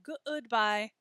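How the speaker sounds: GOODBYE broken as guh-ood-bye; three-syllable goodbye. Shown as a demonstration. Teacher mimics a funny-sounding error, goodbye in three syllables. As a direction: split the good in goodbye into two beats. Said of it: "pronounce it with three syllables".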